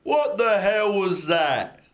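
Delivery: slow, loud, high dopey voice; dumb giant head. Acted. GM voices the dumb Etin head, baffled. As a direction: bellow it slowly like a dim brute. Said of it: "one of them says".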